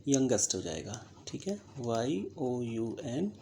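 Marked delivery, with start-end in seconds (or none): 1.8-3.3 letters spelled out slowly, one by one; slow spelling out. Shown as a demonstration. Teacher spells a word out slowly for students. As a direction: dictate each letter with a slow, even pace. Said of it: "spelling पे ध्यान दीजिएगा".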